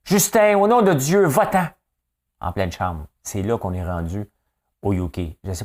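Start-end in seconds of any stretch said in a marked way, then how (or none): 0.0-1.7 much higher and louder, a mock parliamentary outburst; Mélanie Joly yelling. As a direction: shout it at the prime minister like an MP. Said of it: "Mettons Mélanie Joly qui dirait ça". (Mélanie Joly crie à Justin Trudeau, aigu et fort)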